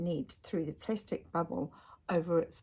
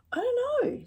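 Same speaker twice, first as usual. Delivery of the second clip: much higher, teasing voice; playful mystery; 5 dB louder than usual. Acted. Dad teases Ned about the plan, high and playful. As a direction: keep it a fun secret. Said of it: "said Dad".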